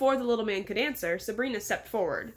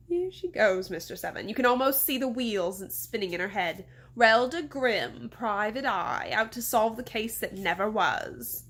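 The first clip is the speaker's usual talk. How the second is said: slow, drawn-out theatrical mockery; mocking mayor. Mayor mocking the grandmother as detective, theatrically. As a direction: announce her grandly, dragging out the title.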